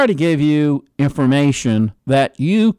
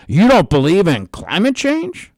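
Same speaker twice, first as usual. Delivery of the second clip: mock accusing question; 7 semitones higher, 3 dB louder than usual. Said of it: "what the left tries to say".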